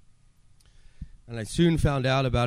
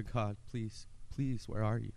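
hushed plea; 8 dB quieter than usual. Retold or imitated. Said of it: "My cries changed".

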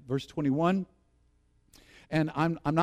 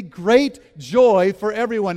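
call and response; 3 semitones higher, 9 dB louder, slower than usual. Shown as a demonstration. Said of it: "Would you say that with me?".

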